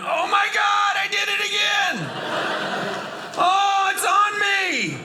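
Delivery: shrieking high panic over the mess; panicked hotel guest. Retold or imitated. Guest waking in soiled bed, shrieking high panic. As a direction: squeal it out like a horrified guest.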